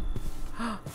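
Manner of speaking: breathless frightened intake of air; sharp gasp. Real, not acted. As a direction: gasp in shock. Sees the monster.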